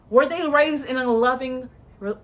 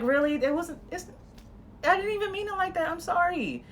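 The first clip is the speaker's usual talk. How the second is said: higher and softer, gentle apology; right way, soft and caring; warm and apologetic; 6 semitones higher, 6 dB quieter than usual. Shown as a demonstration. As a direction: soothe them with soft regret. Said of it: "a warm, loving energy".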